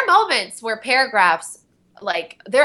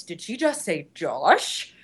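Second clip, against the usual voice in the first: lightly voiced villain, slow and softer; a hint of the general, drawn out and hushed; General Budo's voice; 4 semitones lower, 5 dB quieter, slower than usual. Retelling the studio joke, as the general slowly. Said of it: "If you can imagine in General Budo's voice".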